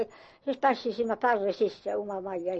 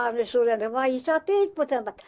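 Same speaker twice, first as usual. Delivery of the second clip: ordinary talk, nothing marked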